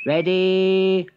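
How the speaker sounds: held, drawn-out call rising to a high final word; suspenseful starting call, jumping up at the end; race starter. A narrator's reading. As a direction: start the race as Mole, stretching each word. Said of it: "Mole lifted the starting flag and said".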